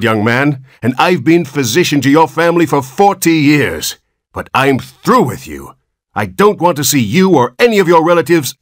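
furious dismissal; doctor angrily disowns the Button family; angry rebuke. Acted.

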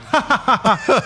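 high mock stage chuckle; imitated celebrity laugh. Imitating celebrities leaning in on stage, mock laughing. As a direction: fake a high show-off laugh. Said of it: "and then they're like".

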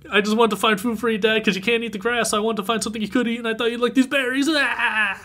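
high, rushed tearful whine, hammed up; mock crying child. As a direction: play a little kid blurting through fake tears.

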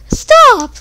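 very high, loud shout; yelled stop. Real, not acted. Gamer yelling at the enemy shooting him. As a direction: scream at them to stop. Being shot at.

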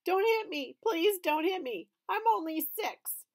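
high, loud crying plea. A narrator's reading. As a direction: beg through tears. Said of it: "Daniel started to cry".

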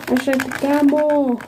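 hurried, voiced like a toy; fast playful repeat; quick toy voice. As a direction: rattle it off fast. Child repeating toy phrase.

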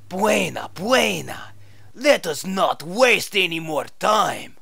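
put-on loud, eager Spanish accent; fake Spanish accent. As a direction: urge them on in Spanish accent. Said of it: "your fake Spanish accent".

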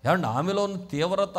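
ordinary talk, nothing marked

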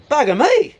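loud, high mock amazement; astonished exclamation. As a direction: burst out as the stunned husband. Husband amazed, punchline of joke.

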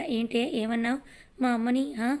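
plain reading voice

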